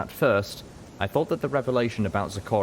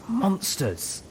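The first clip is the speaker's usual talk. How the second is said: higher, hissed angry word; hissed outrage; 12 semitones higher than usual. A narrator's reading. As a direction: spit out the word in disgust. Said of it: "Velvet Remedy hissed next to me".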